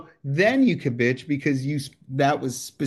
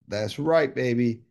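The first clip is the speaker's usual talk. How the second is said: normal talking voice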